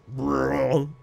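low buzzing tuba noise; mock tuba blast; imitated tuba. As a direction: blurt a low, brassy tuba note with the mouth. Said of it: "that's his tuba".

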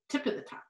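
whispering "Tip of the top" quietly to herself; whispered tongue twister. Shown as a demonstration. Teacher trying the tongue twister again in a whisper. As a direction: breathe "Tip of the top" as a soft practice. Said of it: "That's hard to say".

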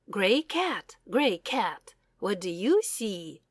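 plain reading voice

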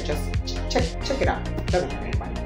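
very soft, half-whispered nudge; muttered aside. Acted. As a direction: mumble it softly under your breath. Muttered plug for own earlier video.

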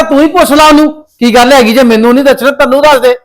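loud demand to his mother; angry shout. Acted. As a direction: yell it, fed up and furious. Family quarrel, raised voices.

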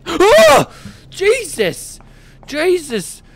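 high shriek then gasped exclamations; shriek, then cry out in shock; real fright; frightened scream. Real, not acted. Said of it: "I screamed like a girl".

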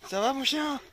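high doggy voice; talking to the dog. Real, not acted. (voix aiguë pour le chien)

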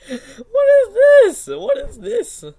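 high, loud, slow shriek of disbelief; squealing at an embarrassing clip. Real, not acted. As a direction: screech it in mock horror. Reacting to bad jump.

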